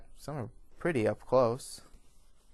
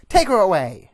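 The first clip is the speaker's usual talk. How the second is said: high, very loud command; commanding full-voiced shout; yelled order; 9 semitones higher, 17 dB louder than usual. A narrator's reading. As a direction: yell the order to the guards. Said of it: "Miss Von Richten yells".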